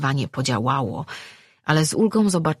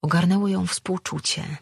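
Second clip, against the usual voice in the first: ordinary delivery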